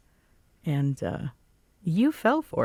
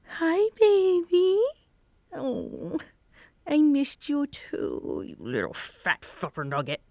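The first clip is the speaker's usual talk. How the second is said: put-on baby talk to a cat; 11 semitones higher than usual. Greeting her cat in baby talk.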